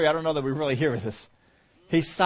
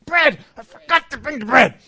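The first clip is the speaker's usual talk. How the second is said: high, loud mock-panic cry about forgotten bread; panicked disciple; 10 semitones higher, 10 dB louder than usual. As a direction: play the flustered disciple realizing the bread is gone. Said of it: "And you think".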